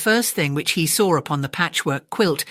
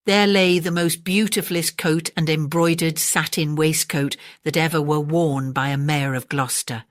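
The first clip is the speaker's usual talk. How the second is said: usual speaking voice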